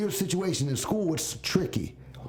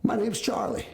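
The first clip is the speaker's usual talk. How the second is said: lightly voiced dad, higher pitch; a hint of a father coaching his son; dad giving advice; 6 semitones higher than usual. Retells dad's advice to him.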